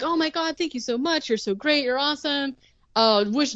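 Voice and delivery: high, breathless gush of thanks; mock gushing mom, thrilled and grateful.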